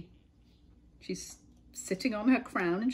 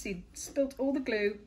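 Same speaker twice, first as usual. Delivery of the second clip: usual speaking voice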